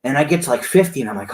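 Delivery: normal talking voice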